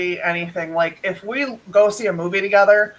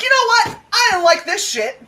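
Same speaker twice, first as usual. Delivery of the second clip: mock complaint; 13 semitones higher than usual. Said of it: "I'll be like".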